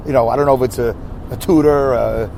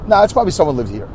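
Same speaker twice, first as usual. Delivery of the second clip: ordinary delivery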